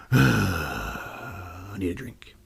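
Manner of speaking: slow unvoiced sigh of a bored demon; weary whisper. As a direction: whisper it after a tired sigh. Sighs, whispered aside as demon.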